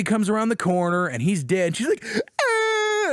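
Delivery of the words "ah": sharp high imitated scream "Ah!"; a high mock cry of shock. Abby screams finding father dead.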